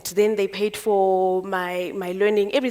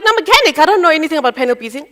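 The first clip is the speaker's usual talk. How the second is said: much higher and louder, rushing the words; startled objection; 6 semitones higher, 6 dB louder, faster than usual. Retold or imitated. Apprentice objecting to the mentor's offer, high, loud and fast. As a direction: blurt the protest fast and high.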